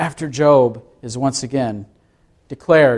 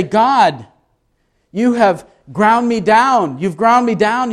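anguished cry; 7 semitones higher than usual. Acted. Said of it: "to cry out to God".

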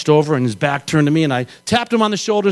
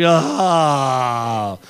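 long, falling, low groan; imitated groan sliding slowly downward; dazed groan; 8 semitones lower than usual. Rider sliding down the wall after crashing, long groan. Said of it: "slid down and went like".

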